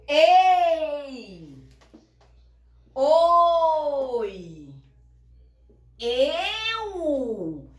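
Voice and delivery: ei, oi, eu stretched very slow and loud; slowly drawn out. Shown as a demonstration. Teacher spelling out the diphthongs slowly for the class. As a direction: draw out each diphthong so they stick. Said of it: "Mas aqui".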